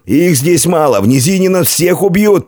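high-pitched urgent encouragement; rally frightened men; rallying shout. A narrator's reading. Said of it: "подбодрил людей командир".

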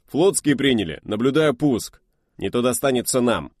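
high, loud urgent call; loud radio report. Acted. Radio operator shouting reports of the missile launch. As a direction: call out the news fast.

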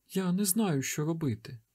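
put-on high, soft panicked child; scared boy, pitched high; child near tears, hushed; frightened boy. A narrator's reading. Terrified boy pleads.

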